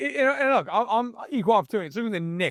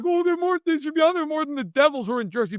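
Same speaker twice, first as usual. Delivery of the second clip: higher, quick mock insistence; mock Buffalo fan; 9 semitones higher than usual. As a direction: parody the fan's argument, pitched up and fast.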